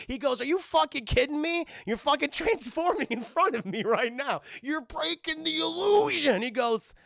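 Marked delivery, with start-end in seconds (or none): 0.4-6.4 high, loud disbelieving rant; outraged. Retold or imitated. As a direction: rage at the obvious fake. Maxie outraged at transforming.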